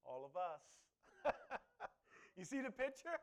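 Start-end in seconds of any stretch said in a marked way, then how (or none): 1.1-1.9 high laugh. Real, not acted. Laugh during sermon.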